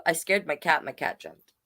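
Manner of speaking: usual speaking voice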